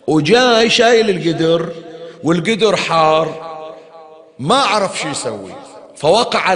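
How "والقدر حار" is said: ordinary talk, nothing marked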